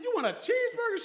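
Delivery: high, loud, excited "You want a cheeseburger?"; hyped-up question. Retold or imitated. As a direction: pump the kid up like a hype man. Grandfather builds the excitement higher.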